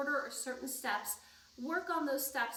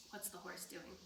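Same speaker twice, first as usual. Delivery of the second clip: hushed; 5 semitones lower, 16 dB quieter than usual. Acted. Sleepy bedtime question to kids.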